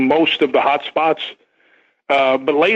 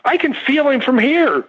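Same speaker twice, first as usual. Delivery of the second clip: much higher woman's voice, hushed wonder; awed girl; 11 semitones higher than usual. Retold or imitated. Seated girl as he walks by, high spooked woman's voice. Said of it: "she goes".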